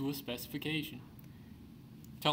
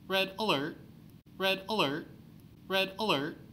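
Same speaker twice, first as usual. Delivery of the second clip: raised, urgent repeated alert; alarm call; 6 semitones higher than usual. Acted. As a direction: call it out like a siren warning. Alarm goes off at headquarters.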